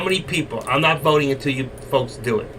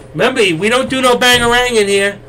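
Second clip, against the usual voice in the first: higher, louder playful warning; mock-stern reminder; 8 semitones higher, 6 dB louder than usual. Real, not acted. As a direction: tease the chat with a rule. Reminds chat, then restates.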